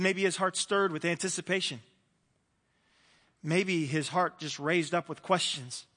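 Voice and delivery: ordinary delivery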